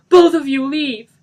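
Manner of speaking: high, very loud order; furious shout. Acted. Denny ordering both out, shouting. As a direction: drive them out.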